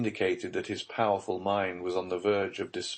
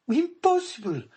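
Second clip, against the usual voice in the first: sharp high cry, louder; cried out; 6 dB louder than usual. Acted. Baroness refusing Villefort's guess about the child, high loud cry. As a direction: fling it back at him in disbelief. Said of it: "cried Madame Danglars".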